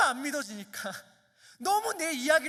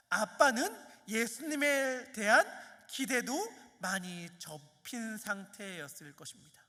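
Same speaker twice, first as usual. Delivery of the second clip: ordinary talk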